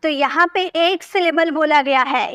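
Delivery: ordinary delivery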